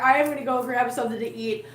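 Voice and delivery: ordinary talk, nothing marked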